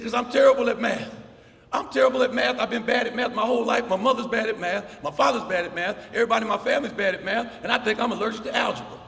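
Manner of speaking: mock tearful wail, high and loud; upset crying boy. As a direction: play the sobbing kid pleading he can't do math. Crying boy voiced in story.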